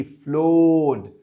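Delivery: फ्लोड drawn out slowly as ploɖ, no R; slow non-rhotic floored. Shown as a demonstration. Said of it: "आर की pronunciation नहीं पाते".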